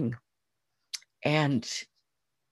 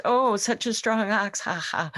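mocking laughter; 7 semitones higher, 3 dB louder than usual. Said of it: "And the townspeople laughed".

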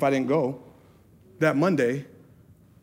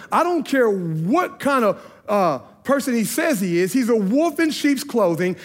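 higher-pitched, emphatic denunciation; indignant objection; 3 semitones higher than usual. Retold or imitated. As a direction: act out the manager's vehement warning. Retold manager's objection to him preaching.

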